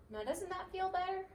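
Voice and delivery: high, caring little voice; gentle mouse voice. A narrator's reading. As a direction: soothe the patient. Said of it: "said Dinky".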